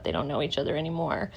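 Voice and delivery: usual speaking voice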